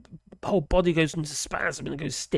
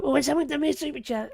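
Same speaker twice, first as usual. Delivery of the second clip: mock excited; 9 semitones higher, faster than usual. Mimics himself getting a super chat.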